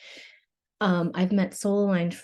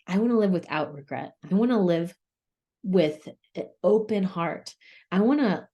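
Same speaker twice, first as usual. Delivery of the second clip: ordinary delivery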